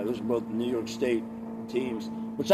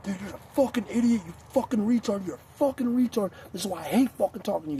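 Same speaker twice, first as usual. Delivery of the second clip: put-on drunk insulting rant; 9 semitones higher than usual. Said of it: "I'm like".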